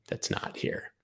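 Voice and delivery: a soft whispered aside. Acted. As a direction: slip it out under your breath. Whispered wish to escape.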